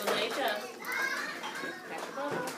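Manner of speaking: ordinary talk, nothing marked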